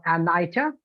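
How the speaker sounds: Anita with NEE turned into NIGH; "Anita" with a long i in the middle; incorrect pronunciation. Shown as a demonstration. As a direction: say Anita as an-EYE-tuh. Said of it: "from that incorrect pronunciation".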